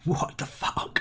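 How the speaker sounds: retold in a stunned near-whisper; hushed shock. Player recalling the self-destruct code backfiring, hushed shock. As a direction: whisper it softly, like a stunned reaction. Quoting shocked reaction to loud scream.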